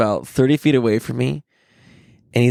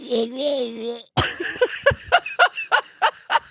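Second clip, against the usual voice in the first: high strangled gurgle; kid's choking noise, retold; imitated choking gag; 10 semitones higher than usual. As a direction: mimic a kid choking.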